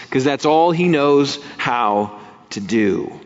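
normal talking voice

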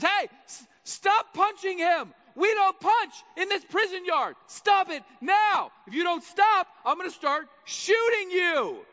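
loud, high yelled commands; furious guard's shout. Acted. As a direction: shout down a fight across the yard. Tower guard Jesus breaks up fight.

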